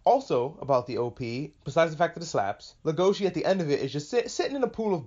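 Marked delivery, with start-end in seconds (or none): none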